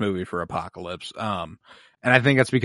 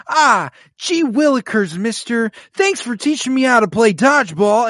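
put-on wholesome 1950s kid; 11 semitones higher than usual. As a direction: chirp like an eager old-timey boy. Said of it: "the kid in the video with Patches O'Houlihan who's like".

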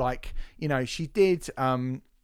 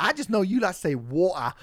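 high, louder mock-British voice; imitated British accent; 6 semitones higher, 5 dB louder than usual. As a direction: put on a playful British accent for "water". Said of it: "she did a sort of British accent".

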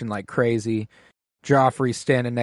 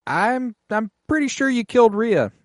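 higher-pitched accusing lord; mock accusing lord; 8 semitones higher than usual. Vale lord confronting Daemon at the wedding, high mock accusation. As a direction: ham up a stern accuser.

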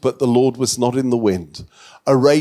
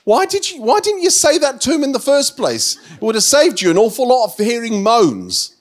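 much higher, incredulous, ending in a laugh; squeaky mock disbelief, louder, breaking into a laugh; mock exasperated question; 8 semitones higher, 5 dB louder than usual. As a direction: throw up your hands at God, half laughing. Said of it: "my question of the Lord was".

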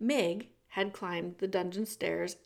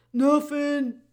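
put-on loud, booming old jailer who speaks of himself by name; the jailer Gregory greeting Meg in a big theatrical voice; 7 semitones higher, 11 dB louder than usual. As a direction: boom it out like a grand old jailer. Gregory dismisses.

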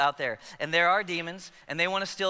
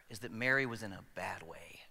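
whispered, much softer; hushed; 10 semitones lower, 10 dB quieter than usual. Real, not acted. Preacher telling the congregation about Mary, hushed whisper. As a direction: let it fall to a whisper.

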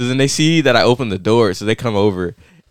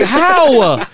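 high, laughing disbelief; baffled, laughing; 12 semitones higher than usual. Retold or imitated. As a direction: squeak out how in amazement. Retold baffled reaction.

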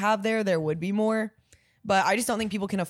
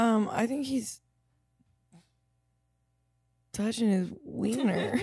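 hushed aside; 7 dB quieter than usual. Real, not acted. Gossiping about a man nearby, softer hushed aside. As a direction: lean in and keep it down.

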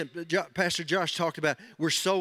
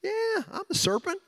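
sly serpent voice; 11 semitones higher than usual. Retold or imitated. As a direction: play the cunning snake sweet-talking. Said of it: "He came up".

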